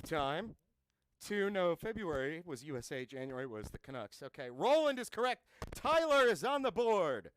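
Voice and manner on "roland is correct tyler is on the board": loud, high game-show proclamation; obnoxious announcing. Acted. Trivia host announcing who scored, obnoxiously loud. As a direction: belt out the winner like an over-the-top host. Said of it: "stop being obnoxious".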